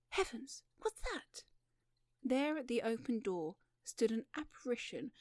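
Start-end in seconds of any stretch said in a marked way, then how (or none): 0.1-1.4 high, frightened exclamation; startled alarm. Acted. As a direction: break off as if seeing something dreadful. Sudden fright at an apparition.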